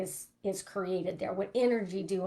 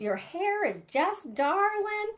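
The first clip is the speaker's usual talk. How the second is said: put-on high, slow Southern drawl of an older lady; 10 semitones higher, slower than usual. Older Southern lady complimenting a stranger's hair, high sweet drawl. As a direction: coo it like a sweet Southern grandma. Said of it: "she did talk like that 'cause she was from, like, s-, the South".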